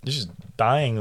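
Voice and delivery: ordinary talk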